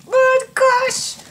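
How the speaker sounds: high shriek of delight; excited shriek. Real, not acted. Excited outburst.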